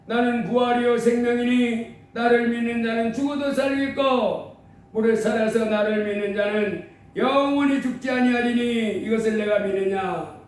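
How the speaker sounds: solemn, measured recitation; scripture reading. Acted. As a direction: recite it like holy words. Scripture read in held voice. (성경 구절을 장엄하게 낭독하듯)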